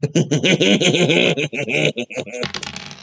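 squeaky gleeful gremlin giggle; gremlin laugh. Real, not acted. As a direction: cackle like a little gremlin. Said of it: "Don't mind my gremlin laugh".

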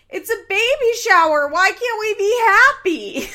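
high, loud whiny mock yell; imitated shout. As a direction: wail it like an upset son. Bar shouting during the fight.

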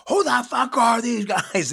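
mock outraged cop. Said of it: "he's like".

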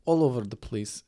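put-on Ukrainian accent; light comic Ukrainian accent on the vowels; Natalie. Cohost mimicking a reality show bride, Ukrainian accent. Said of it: "She talks like this".

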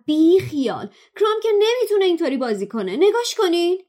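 high, loud, rushing outcry; excited protest. Acted. Ron urging his friends to watch Krum, high excited outcry.

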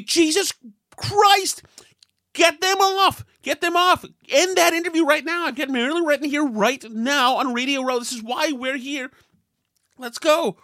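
host losing it on the phone with the producer, exasperated yell. Retold or imitated. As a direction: blow up at the producer to end it. Third call, interview still running.

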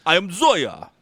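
heavy Russian I am Zoya; Russian accent. Pretend. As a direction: announce it in thick Russian. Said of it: "Russian accent".

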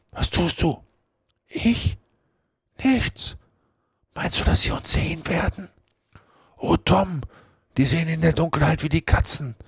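put-on high-pitched, panicky boys' voices; urgent, scared exchange in a strained high voice; frightened. Frightened boys hear someone coming.

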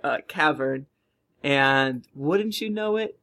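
usual speaking voice

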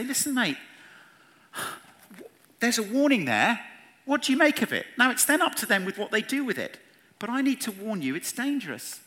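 high-pitched, insistent appeal to a mate; concerned warning. Acted. Friend warns a mate smoking eighty a day, urgently.

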